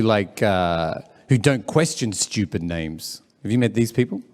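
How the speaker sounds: usual speaking voice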